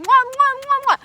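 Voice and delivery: loud, high smacking kisses for Adam; shower him with kisses; doting God blowing kisses; loving kisses. Acted.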